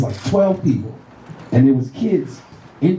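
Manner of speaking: ordinary talk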